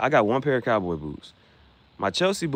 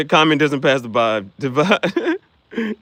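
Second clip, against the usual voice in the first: laughing; 4 semitones higher, 6 dB louder, much slower than usual. Shown as a demonstration.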